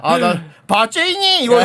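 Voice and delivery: normal talking voice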